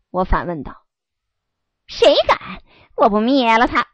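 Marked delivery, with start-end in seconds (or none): 1.9-3.9 loud boastful shout. Acted. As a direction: bellow the threat. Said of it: "小犬春浪高叫着".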